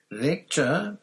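high, loud call into the trees; shouting. A narrator's reading. Harry calling for Krum in the dark forest. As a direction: call out to find him. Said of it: "Harry shouted".

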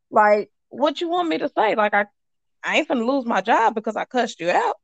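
usual speaking voice

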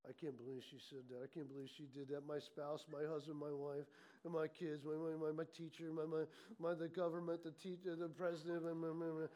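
low, hushed grumbling under the breath; murmuring complaint. Acted. Preacher acts someone letting complaints out, low muttered murmuring. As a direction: mutter a running list of grievances. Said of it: "you're just kinda letting it out".

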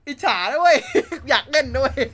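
high and loud, laughing through the words; laughing confession. Real, not acted. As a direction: blurt out the envy while cracking up. Admits envy, laughing. (หัวเราะไปพูดไปเสียงสูงดัง)

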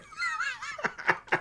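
high burst of laughter. Real, not acted. Cohost laughing after the therapist joke, high laugh. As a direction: laugh at your own joke.

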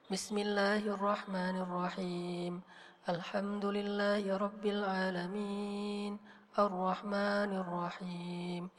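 soft, slow and low Quran recitation; sad recitation. Shown as a demonstration. Teacher showing Quran read without melody, sadly. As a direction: recite sorrowfully with hardly any melody. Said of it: "baca Al-Quran tanpa mengiramakan tapi sedih".

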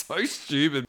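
ordinary talk, nothing marked

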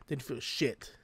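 ordinary talk, nothing marked

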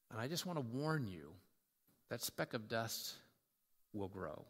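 low, subdued confiding voice with long pauses; humble, gentle. Acted. Humbled man warning his buddy from experience, gentle.

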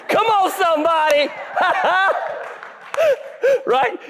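high, hyped call to the congregation with a laugh; rally the room, then laugh it off; excited call out. Real, not acted. Preacher rallies crowd, laughing.

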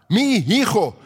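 "Mi hijo" said as two Spanish words, mee EE-ho; "Mi hijo" with a Spanish throaty h. Shown as a demonstration. Preacher teaching Spanish, saying "Mi hijo" as two words. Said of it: "They put two words together".

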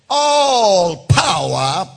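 drawn-out loud declaration of all power; proclaimed quote. Acted. Preacher declaiming Jesus' words to the church. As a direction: ring out each word slowly.

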